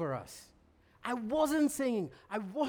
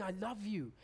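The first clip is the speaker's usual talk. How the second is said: tender whisper of love; 9 dB quieter than usual. Retold or imitated. God speaking through found coins.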